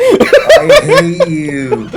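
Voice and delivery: very high, very slow laughing wail; laughing whine. Real, not acted. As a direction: stretch it out, cracking up. Repeats it laughing.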